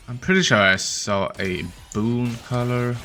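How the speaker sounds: usual speaking voice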